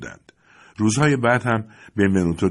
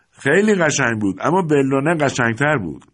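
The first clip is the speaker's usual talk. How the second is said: higher and louder, a glad shout; 7 semitones higher, 4 dB louder than usual. A narrator's reading. Thomas shouting to the mothers, playful loud call. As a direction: call it out across the station. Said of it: "توماس فریاد زد".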